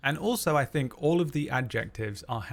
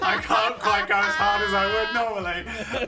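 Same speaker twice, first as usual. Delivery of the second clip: louder and higher, laughing through it; laughing while dancing; 9 semitones higher, 5 dB louder than usual. Real, not acted. Dancing in tight corset, laughing.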